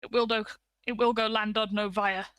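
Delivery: usual speaking voice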